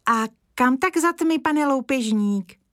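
put-on a scared boy chattering to a stranger; nervous little boy. Kája near tears.

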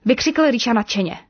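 usual speaking voice

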